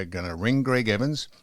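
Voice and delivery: usual speaking voice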